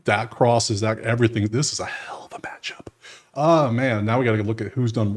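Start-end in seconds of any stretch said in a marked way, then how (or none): 1.7-2.9 whisper. Real, not acted. Excited about a matchup.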